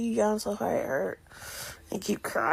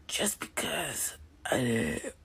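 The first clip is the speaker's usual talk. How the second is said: whispering; 6 semitones lower, 8 dB quieter, slower than usual. Real, not acted. Tearful whispered pep talk.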